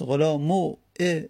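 slow غلامو ا, "u" then a separate "e"; two clashing vowels. Shown as a demonstration. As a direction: draw out غلامو, then add ا as a lone "e". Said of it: "هر دو با هم که نمیشه". (غلامو ا کشیده، ضمه و کسره پشت هم)

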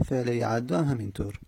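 ordinary talk, nothing marked

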